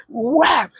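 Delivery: smack it out like a paddle hit; imitated paddle smack. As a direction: mouth sound of a paddle swat. Paddle hitting classmate.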